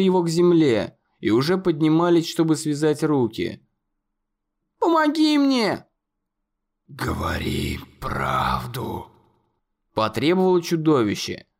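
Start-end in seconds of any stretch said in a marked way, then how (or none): 4.8-5.9 very high, desperate cry for help; cry of terror. A narrator's reading. Conor crying out as tendrils grip him. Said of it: "Он взвыл от ужаса". 6.9-9.1 very low, slow, merciless demand; merciless, menacing. A narrator's reading. Monster demanding the truth, menacing. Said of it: "Его голос был безжалостным и грозным".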